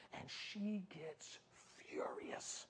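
slow, very soft, falling to a whisper; ominous near-whispered storytelling; hushed suspense. Real, not acted. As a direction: drop to a whisper on furious. Hushed suspense before the queen's threat.

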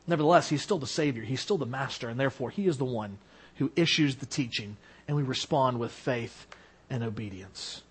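drops to a low, soft hush; hushed, falling preacher voice; quiet. Real, not acted. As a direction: sink the voice almost to nothing.

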